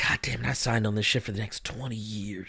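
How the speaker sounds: low, quiet, resentful grumble; muted mock exasperation. Host voicing Tom signed on for twenty years, quietly exasperated. Voices Tom's bitter regret.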